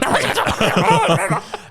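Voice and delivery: high, frantic gremlin babble; imitated gremlin noise. Neighbours denying they saw gremlins, high gremlin babble. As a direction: gibber like a gremlin, breaking into laughter. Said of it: "And they go".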